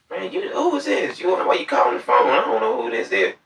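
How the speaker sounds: high mock woman's voice, annoyed; imitating the woman on the phone, high and sharp; indignant woman. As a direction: play the woman, high and put out. Woman questioning caller.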